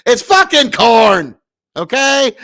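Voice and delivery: slow, loud shouted corn-farmer drawl; Nebraska anger drawl. Acted. Streamer to chat insisting it's corn, angry shout. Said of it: "Nebraska anger drawl".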